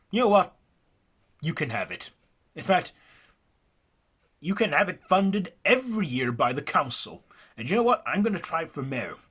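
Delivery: high, loud, worked-up pledge; rally the town like a stump speech; rousing boast. Acted. The local promising Jackson a funded carnival, fired up. The local getting carried away.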